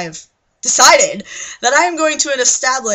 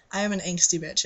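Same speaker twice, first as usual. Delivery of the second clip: ordinary talk, nothing marked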